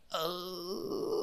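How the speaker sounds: very soft, high, drawn-out oh; spooked little oh.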